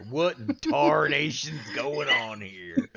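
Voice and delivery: over-the-top Southern twang shout; hammed-up Southern accent. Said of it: "he's gone full Southern".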